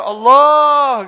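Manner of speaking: loud, long call of Allah; a drawn-out cry of the name; shouted Allah. Retold or imitated. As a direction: call out to God from the driver's seat. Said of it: "teriak tuh".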